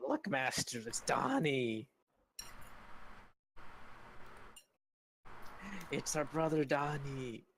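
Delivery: lightly voiced pleading goblin; a hint of an excited little goblin. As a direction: goblin calling out to master. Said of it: "one of the goblins in your party speaks up and goes".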